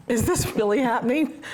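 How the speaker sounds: incredulous and tickled at the stranger's attention; laughing disbelief. Retold or imitated. Retelling a stranger unloading on her, laughing in disbelief. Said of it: "I'm looking around like".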